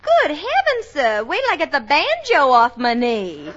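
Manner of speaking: coy Southern belle voice; put-on Southern accent. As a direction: lay the Southern charm on thick for the boss. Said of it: "I come from Alabama".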